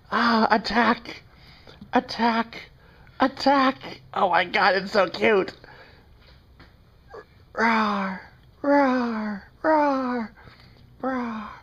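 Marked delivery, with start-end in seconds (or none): 0.1-4.0 rising higher, playful mock panic; higher and higher playful attack cry; mock alarm. Playing kitten attacking. 7.5-11.5 playful tiny roar, higher; much higher cute mock roar. Roaring for kitten.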